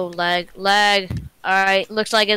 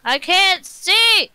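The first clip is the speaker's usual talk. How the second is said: louder slow whine; 9 semitones higher, 4 dB louder, slower than usual. Real, not acted. Louder repeat of the complaint.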